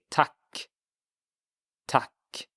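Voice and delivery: teacher models thanks for learners; Swedish tack. Shown as a demonstration. Teacher repeats tack for the class. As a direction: tack said as a short "tahk". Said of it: "you would say".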